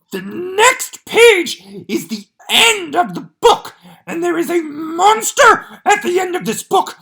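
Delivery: a panicked puppet monster begging the reader; Grover voice. Acted. Grover voice reading.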